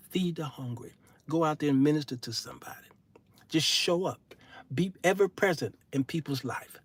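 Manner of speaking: urgent hushed whisper; a whispered sermon on good deeds. Acted. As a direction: lean in and whisper the advice. Urging good works, dropping voice.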